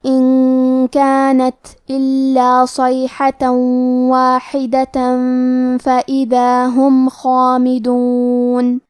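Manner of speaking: slow melodic tajweed recitation; Quran recitation. Acted. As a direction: recite slowly, each letter drawn out. (دھیمی قراءت تجوید کے ساتھ)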